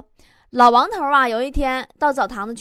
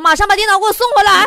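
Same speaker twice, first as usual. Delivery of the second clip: demanding order; 5 semitones higher, 8 dB louder, faster than usual. Acted. Interviewer calls back angrily.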